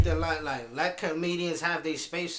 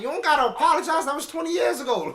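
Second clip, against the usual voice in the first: higher, louder and fast, exasperated; quick, raised, fed-up protest; exasperated outburst; 8 semitones higher, 4 dB louder, faster than usual. Retold or imitated. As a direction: blurt it out in disbelief at them. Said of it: "I'm like".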